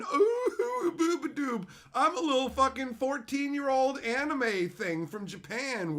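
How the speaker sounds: high squeaky cutesy voice; hammed-up kawaii squeal; mock anime girl. Mocking an anime girl, high squeaky cutesy voice.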